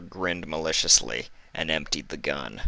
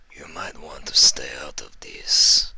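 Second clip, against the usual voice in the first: put-on whispered threat in a thick northern accent; 3 semitones lower than usual. Big stallion warning Vinyl off, thick northern accent. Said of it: "he said with a thick northern accent".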